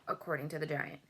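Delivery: usual speaking voice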